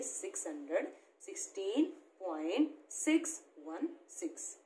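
after the point read digit by digit, "six वन six" as separate digits; correct reading demo. Shown as a demonstration. Teacher shows children the right way, reading "six वन six" as single digits. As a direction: spell out "point six वन six" one digit at a time, the right way. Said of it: "बच्चों हमें इसको यही पढ़ेंगे".

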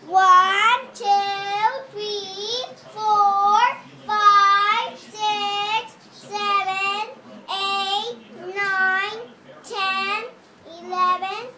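shouting out the numbers; loud counting. Real, not acted. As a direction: count them out loudly.

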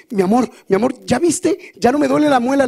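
high, lively wife voice; excited wife. Retold or imitated. Wife gushing to her husband, high and excited. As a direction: gush to your husband.

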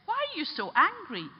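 high-pitched, puzzled challenge; retold earnest challenge; challenging question. Priest challenges the angry man.

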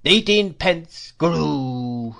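put-on high, loud, slow old man's whine; crazed old dealer's swelling, falling wail; singsong wailing cry. A narrator's reading. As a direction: draw out each cry like a gust of wind. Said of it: "delivered in a sort of tune".

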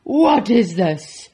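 loud, slow, outraged bellow; angry roar. Acted. Duke disgusted by his roll, angry roar. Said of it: "roared the Duke".

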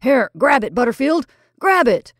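loud, high urgent order; stern command. A narrator's reading. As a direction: shout for help catching it. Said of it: "said Mister Tate sternly".

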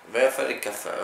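put-on Sicilian accent. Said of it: "Dillo in siciliano".

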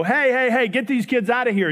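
put-on half-laughing hey hey hey to clear the kids out; shooing adults. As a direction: shoo the kids off.

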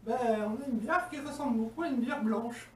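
talking while sniffing drink; glass in front of mouth. Real, not acted. Seen 0: glass raised to nose, sniffing beer while talking, no sip seen.

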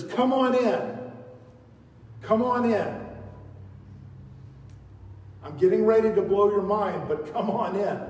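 high, loud, booming invitation; God calling out come on in; God's loud welcome. Acted. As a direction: call Isaiah in with big excitement. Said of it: "and says".

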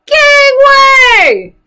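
very high, loud yell. Shown as a demonstration. Little pirates yell to their mommy in a very high, loud yell. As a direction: clear the way at full voice. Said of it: "Can you yell that".

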